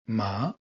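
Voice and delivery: MA rising; second tone. Shown as a demonstration. Teacher gives the word for numbness, rising tone. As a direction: let "Ma" climb in pitch to its end.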